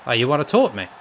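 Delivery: usual speaking voice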